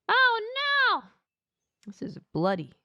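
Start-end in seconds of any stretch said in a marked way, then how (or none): 0.1-1.0 high, loud cry of dismay; mock horror. Alien turns towards Ella and Johnny, mock horror. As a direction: exclaim in hammed-up panic.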